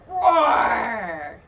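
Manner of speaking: loud pretend lion roar; playful roar. Teacher roaring like a lion for the children. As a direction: roar big for the kids. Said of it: "And I will roar and roar".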